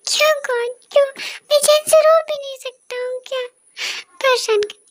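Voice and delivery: high, tearful whine; crying boy annoyed at being disturbed; sobbing complaint. Acted. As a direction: whine through tears. Jungkook crying again, annoyed.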